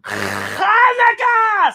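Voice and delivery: very high, drawn-out shout; stretched cheer. Acted. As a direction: belt out the holiday. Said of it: "says Grandpa".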